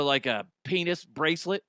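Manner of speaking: normal talking voice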